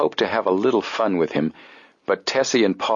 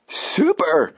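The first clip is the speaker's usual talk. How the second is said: excited exclaim; 10 semitones higher than usual. Acted. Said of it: "exclaimed Chet".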